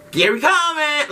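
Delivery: high, jeering schoolkid taunt; mock taunting. Bullies taunting him from the bus seats, jeering and high. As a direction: call out a mocking nickname.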